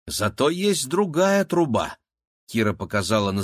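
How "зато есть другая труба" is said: put-on high, slow, lilting girl's voice; hopeful girl. A narrator's reading. Girl shows her brother another way out, hopeful. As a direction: offer the idea high and drawn out.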